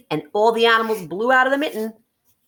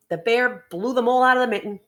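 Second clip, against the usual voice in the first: plain reading voice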